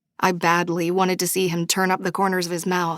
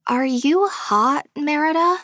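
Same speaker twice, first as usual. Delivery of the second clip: put-on high, slow, sweetly innocent girl's voice; teasing friend feigning innocence; innocent question; 9 semitones higher, 3 dB louder than usual. A narrator's reading. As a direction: ask it all innocence, slowly and high. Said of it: "Charmaine asked innocently".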